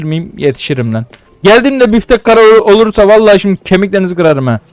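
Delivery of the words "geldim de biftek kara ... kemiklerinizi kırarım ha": higher and louder, half-joking threat; angry threat. Real, not acted. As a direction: threaten them over the steak. Yanan bifteğe kızıp tehdit ediyor. (yarı şaka öfkeli tehdit)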